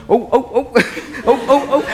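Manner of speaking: high, awkward little grunts while squeezing past; mock-pained oh's, cramped for space; squeezing past the father-in-law in the kitchen, high strained oh's.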